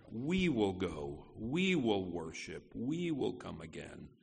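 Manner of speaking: "We" lifted at the start of each clause, then falling away; low, measured repeats, leaning on every "We"; stress on We. Shown as a demonstration. Pastor teaching the congregation Abraham's faith, stress on "We". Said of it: "the emphasis".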